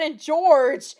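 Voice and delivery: usual speaking voice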